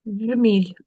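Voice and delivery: ordinary talk, nothing marked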